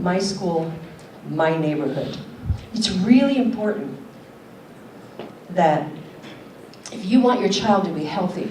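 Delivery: normal talking voice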